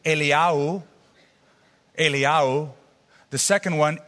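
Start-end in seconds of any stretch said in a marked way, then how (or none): 0.1-0.8 "Eliyahu" said ay-lee-YA-hoo; Hebrew pronunciation. Shown as a demonstration. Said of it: "The first prophet is pronounced Eliyahu". 2.0-2.7 "Eliyahu" repeated as ay-lee-YA-hoo; Hebrew pronunciation. Shown as a demonstration. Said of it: "The first prophet is pronounced Eliyahu".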